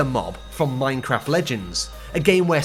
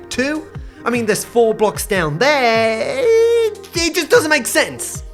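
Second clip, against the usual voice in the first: wild unhinged ranting; 7 semitones higher, slower than usual. Real, not acted. Exasperated rant about fence sizes.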